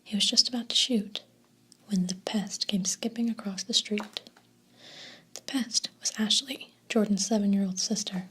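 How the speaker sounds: ordinary delivery, nothing marked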